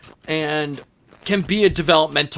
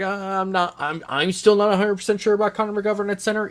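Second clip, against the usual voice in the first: waver up high, unconvinced; hesitant doubt; 8 semitones higher, faster than usual. Acted. Host voicing doubts about a player at center, hesitant and higher. Voices own doubt in a quote.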